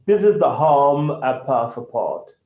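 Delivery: slow, careful British accent. Shown as a demonstration. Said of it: "so that's British".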